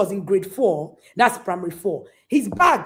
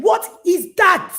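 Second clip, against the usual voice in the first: higher, louder, slow outrage; indignant challenge; 9 semitones higher, 6 dB louder, slower than usual. Real, not acted. Preacher scorning the excuse, high and slow. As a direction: scoff at the excuse.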